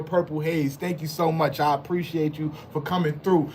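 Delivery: ordinary talk, nothing marked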